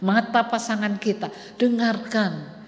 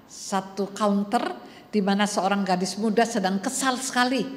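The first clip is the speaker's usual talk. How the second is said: normal talking voice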